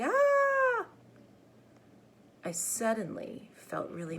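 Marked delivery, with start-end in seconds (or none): 0.0-0.9 imitated yelp. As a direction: echo of the little brother's cry.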